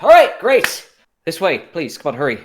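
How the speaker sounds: high, eager burst; excited. Acted. Gearfried leads the acolyte to the vehicle, excitedly. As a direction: burst out high, hurrying them along. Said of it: "Rather excitedly".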